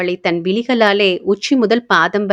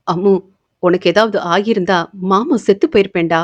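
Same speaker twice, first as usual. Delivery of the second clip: usual speaking voice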